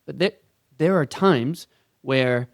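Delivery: normal talking voice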